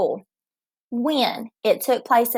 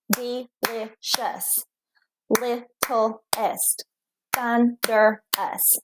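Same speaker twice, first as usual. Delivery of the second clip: Delicious, littlest, thunderous drawn out slowly, softer; slow syllable count; 4 dB quieter, much slower than usual. Shown as a demonstration. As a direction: stretch each word into three slow beats. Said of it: "They have three".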